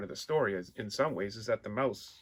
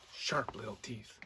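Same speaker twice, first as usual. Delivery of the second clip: softer, hushed "sharp little teeth"; hushed repeat; 3 semitones lower, 10 dB quieter than usual. A narrator's reading. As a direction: lean in and breathe the words to the children. Repeated phrase to children.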